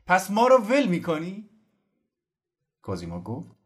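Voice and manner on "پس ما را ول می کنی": high, loud reproach; shouting. Acted. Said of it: "سردار فریاد زد".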